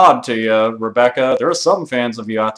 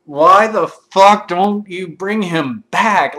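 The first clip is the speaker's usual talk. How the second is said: slow, louder, higher exasperated plea; exasperated demand; 5 semitones higher, 4 dB louder, much slower than usual. Retold or imitated. Fan pleading with Capcom to bring Barry back, slow exasperation. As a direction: drag it out in disbelief.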